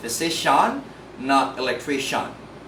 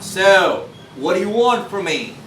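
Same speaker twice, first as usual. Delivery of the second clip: louder, higher so held with a long O; 3 semitones higher, 6 dB louder than usual. Acted. Speaker acting a confrontation to show a long O so. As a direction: stretch the So, then challenge. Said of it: "pronounce it with long O sound".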